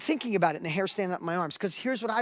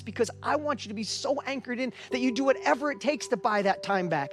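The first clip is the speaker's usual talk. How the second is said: high, driving motivational speech; motivational preaching; 5 semitones higher, 4 dB louder than usual. Real, not acted. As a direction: build to a rousing, loud peak. Motivational speaker at full force.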